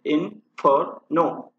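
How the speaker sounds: "इन्फर्नो" slowly in three separate syllables. Shown as a demonstration. Teacher counting the syllables of इन्फर्नो, syllable by syllable. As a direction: break "इन्फर्नो" into in, fer, no. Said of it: "इन्फर्नो में तीन syllable आते हैं".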